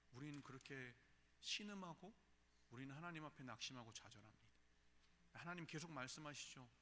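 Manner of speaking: ordinary talk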